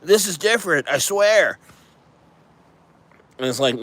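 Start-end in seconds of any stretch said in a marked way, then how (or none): 0.0-1.6 mocking the team.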